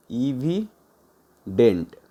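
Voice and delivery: Evident very slow, a gap before dent; broken in two. Shown as a demonstration. Teacher giving learners a synonym, evident slowly broken in two. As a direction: say इवि, pause, then dent.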